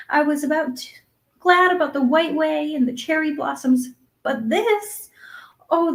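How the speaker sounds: put-on high, overwhelmed girl's voice; dazed with joy, lingering on words; bewildered. A narrator's reading. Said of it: "in a tone of bewilderment".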